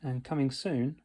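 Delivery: audiobook outro. Acted. Narrator closes the audiobook for listeners, loud announcer style. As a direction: sign off like a channel host.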